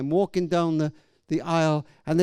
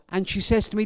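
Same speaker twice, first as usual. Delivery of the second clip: ordinary talk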